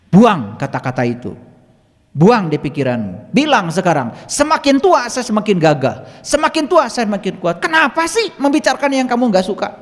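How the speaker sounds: loud scolding that climbs high. Retold or imitated. Healer orders patient to drop weak words, scolding. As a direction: drill the new words in forcefully, like a lecture. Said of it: "Saya omelin".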